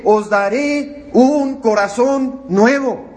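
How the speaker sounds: slow solemn reading. Acted. Quoting scripture solemnly.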